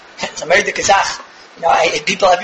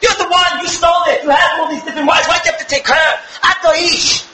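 prophet's rebuke; 7 semitones higher than usual. Acted. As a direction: thunder the accusation at him. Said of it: "And Natan said".

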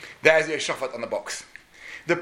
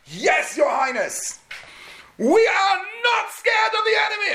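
soldiers shouting back. Retold or imitated. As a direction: bellow back full of bravado. Soldiers answering the general.

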